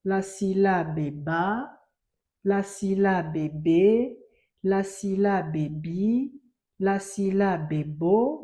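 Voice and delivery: slow, "la syllabe ba" with the last syllable stretched upward; read-along drill. Shown as a demonstration. Teacher has pupils read "la syllabe ba" together, slow rising drill. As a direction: lead the class through "la syllabe", slowly, lifting each ending. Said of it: "On peut lire ensemble".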